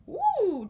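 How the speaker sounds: high gleeful ooh; delighted ooh. Acted. Sheriff dreaming of parties, high gleeful ooh. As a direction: squeal of villainous delight.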